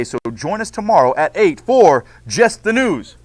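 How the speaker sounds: bright, announcing host read; news anchor. Acted. Teacher reads class news script to students, anchor style. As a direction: open the broadcast like a TV host.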